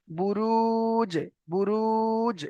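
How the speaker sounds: বুরুজ with slow, level long u and echoing j; long vowel, qalqalah. Shown as a demonstration. Teacher models recitation, softly drawn-out বুরুজ with qalqalah. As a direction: hold the second u of বুরুজ, then bounce the j. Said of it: "এমনভাবে উচ্চারণ হবে".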